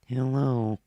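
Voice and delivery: loud inhaled Speak and Spell robot voice. Shown as a demonstration. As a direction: talk while breathing inward like a robot. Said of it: "speak and spell sound".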